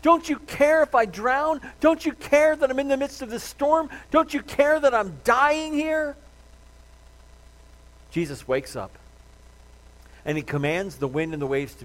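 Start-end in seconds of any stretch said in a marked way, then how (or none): 0.0-6.2 high, loud, drawn-out plea; crying out to God. Acted. Believer in a furious storm, crying out to God. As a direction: implore at the top of the range, stretching each question. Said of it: "you're crying out to God".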